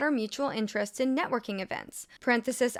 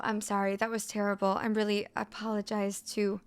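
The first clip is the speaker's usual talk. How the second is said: ordinary talk, nothing marked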